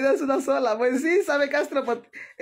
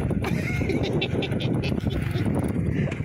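high airy burst of laughter; breathless laugh. Real, not acted. Woman laughs at her own fright, airy laugh. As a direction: let out a wheezing laugh.

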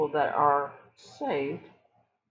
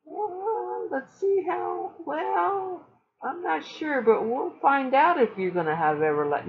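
much higher, sing-song mock uncertainty; high-pitched sarcastic hedging; mocking doubt; 12 semitones higher than usual. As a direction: parody a preacher who keeps you guessing.

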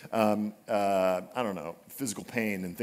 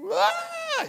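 cry of pain. Retold or imitated. Daughter cries after hitting head.

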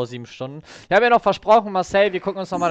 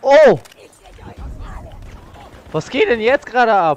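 surprised; 6 semitones higher, 5 dB louder than usual. Real, not acted. Raid suddenly arrives on stream.

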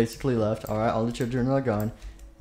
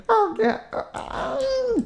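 very high squeak, drawn out; a tiny squeaky creature voice; much slower than usual. Acted.

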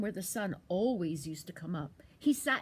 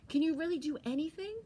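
high, quick little squirrel; 8 semitones higher, faster than usual. Acted. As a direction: pipe up like a tiny squirrel. Squirrel asks again.

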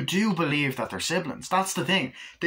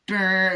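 a buzzing "brr" alarm sound, louder and higher; imitated car alarm; 8 semitones higher, 6 dB louder than usual. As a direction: mimic a car alarm going off. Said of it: "it goes".

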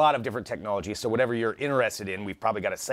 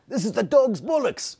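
much higher, louder hammed-up British accent; terrible British accent; 12 semitones higher, 4 dB louder than usual. Host tries a terrible British accent for co-host. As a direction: overdo a bad British accent, pitched way up. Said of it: "terrible British accent".

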